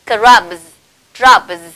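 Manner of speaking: loud, careful "Crabs, drabs" with voiced z; voiced z endings. Shown as a demonstration. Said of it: "cùng nhau thực hành các âm này".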